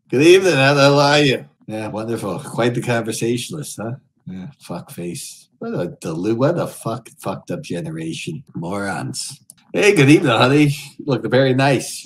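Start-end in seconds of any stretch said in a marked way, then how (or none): 0.1-1.5 loud, high leering lounge-lizard greeting; sleazy greeter. Acted. Streamer greets Omegle strangers as a leering creep, loud and high. 4.3-5.5 muttered insult. Real, not acted. 9.7-12.0 loud, high leering lounge-lizard greeting; sleazy greeter. Acted. Streamer greets Omegle strangers as a leering creep, loud and high.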